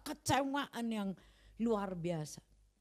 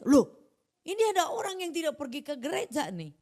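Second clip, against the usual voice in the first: surprised neighbour; 6 semitones higher, 5 dB louder than usual. Retold or imitated. Neighbour spots man at home.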